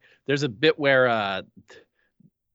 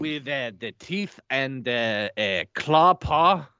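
halting mock-French hunt for words; put-on French accent, searching for the English; slower than usual. Undercover [name] fumbling through dog anatomy, French accent. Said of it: "Where's your French accent?".

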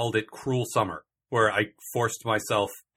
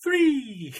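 high, loud excited yell; excited shout; 13 semitones higher, 4 dB louder than usual. Retold or imitated. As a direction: shout it like you're thrilled to be here. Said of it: "he sounds so happy to be here".

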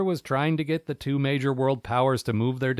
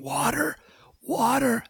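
high, pleading cry for water; parched desert plea; 11 semitones higher, slower than usual. Pretend. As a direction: gasp out water like you're dying of thirst. Mock desert crawler panting.

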